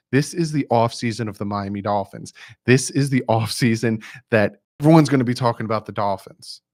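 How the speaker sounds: ordinary talk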